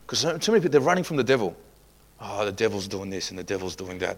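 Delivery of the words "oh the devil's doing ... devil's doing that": low, soft grumbling imitation; mock complaint. Preacher imitating people running from the devil, mock complaint. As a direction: imitate a defeated moaner under the breath.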